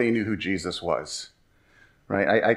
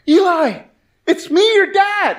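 high, loud yell across the mall; embarrassing dad calling out; 6 dB louder, slower than usual. Acted. Dad hailing his son among friends at the mall, loud high call. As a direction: holler much higher, stretched out to carry.

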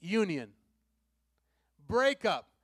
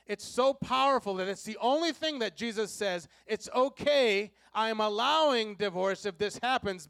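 preaching at full force; 4 semitones higher, 5 dB louder than usual. Real, not acted. Preacher building again.

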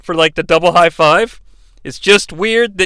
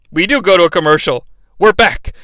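normal talking voice

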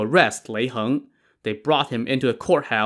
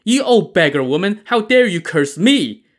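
much higher, louder indignant yell; angry shout; 8 semitones higher, 6 dB louder than usual. A narrator's reading. Bai Xiuying yelling at the old mother, indignant shout. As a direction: scream it, outraged she was cursed. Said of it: "Bai Xiuying glared".

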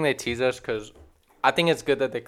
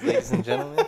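laughing announcer; 5 dB louder than usual. Acted. Mock announcer flourish.

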